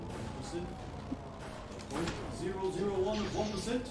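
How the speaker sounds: rough attempt at an old Brooklyn senator, rising on percent; Bernie Sanders. Podcaster practising his impression for his co-host: lightly voiced stump speech. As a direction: try out an old-man stump-speech voice, half-joking. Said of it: "My Bernie impersonation".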